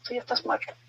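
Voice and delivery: whispering to the dead husband's ghost; whispered terror. Retold or imitated. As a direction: hiss it out, scared. Unvoiced, much softer.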